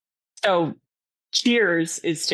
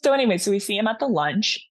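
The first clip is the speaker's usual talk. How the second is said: ordinary delivery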